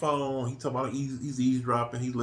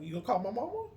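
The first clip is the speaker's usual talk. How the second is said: mock dad; 12 semitones higher, 5 dB quieter, faster than usual. Imagining dad's thought.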